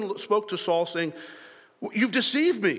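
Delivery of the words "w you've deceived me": high, mild mock outcry; retold scream. Woman crying out to Saul, mild high mock scream. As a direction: imitate a shocked accusation without full screaming. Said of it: "she screams".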